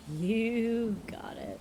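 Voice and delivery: slow breathy whisper of "You got it". Acted. Streamer answering chat, slow whisper. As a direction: whisper it slowly back.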